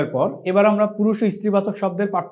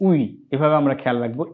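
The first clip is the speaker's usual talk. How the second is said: normal talking voice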